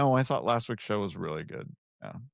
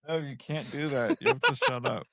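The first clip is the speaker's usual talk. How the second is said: ordinary delivery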